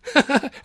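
high loud laugh; bright laugh. A narrator's reading. Tilly laughing at Arthur, bright high laugh. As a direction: burst out laughing.

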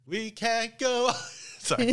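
high mock-dramatic line dissolving into laughter; laughing. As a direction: ham it up, then crack up. Mock melodrama, breaking into laughter.

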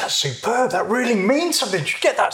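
high, bright delighted praise. Retold or imitated. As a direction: praise him high and pleased. Quoting the delighted supervisor.

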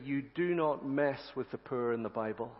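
ordinary delivery

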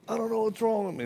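high, teary mock sob of a broken man; imitating crying. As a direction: whimper it like he's falling apart. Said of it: "And he starts crying".

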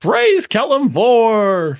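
loud, slow, high exaltation; excited cheer. Acted. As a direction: cry it out in triumph. Players cheer the deity.

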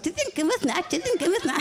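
high mock Iki, repeating; high looping imitation of Iki; mimicking Iki. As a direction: pitch up and parrot it. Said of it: "og han siger".

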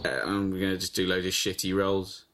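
low, mumbled film-star parody; Johnny Depp. Acted. Game player mocking a film actor, low hesitant mumble. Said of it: "I'm gonna do Johnny Depp".

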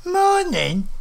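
high-pitched indignant echo, rising in disbelief; livid. A narrator's reading. Old woman livid at being greeted with morning, indignant. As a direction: throw the greeting back in high outrage. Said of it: "She was livid".

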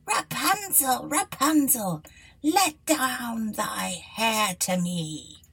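high, slow call up to the tower; drawn-out witch call; the enchantress calls up to the window. A narrator's reading. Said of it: "she placed herself beneath it and cried".

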